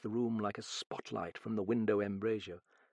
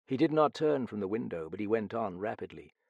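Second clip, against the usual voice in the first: usual speaking voice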